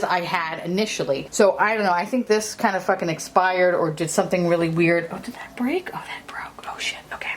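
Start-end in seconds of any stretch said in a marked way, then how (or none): 5.1-7.3 real startled whisper, unvoiced and soft. Real, not acted. Breathing it out after the palette breaks, whispering. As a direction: hush it as if something just cracked in your hand. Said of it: "I don't know why I'm whispering".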